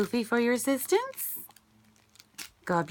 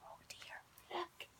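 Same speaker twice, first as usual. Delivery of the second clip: whisper; 9 semitones higher, 15 dB quieter than usual. Real, not acted. Teacher whispering to children mid-story. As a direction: whisper it like a secret.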